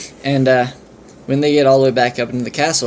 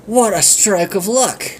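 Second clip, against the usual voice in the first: amazed; 7 semitones higher, slower than usual. Acted. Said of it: "Fillmore's like".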